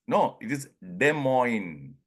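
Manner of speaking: Des Moines said slowly, de moyn, both S silent. Shown as a demonstration. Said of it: "so I'm pronouncing Des Moines".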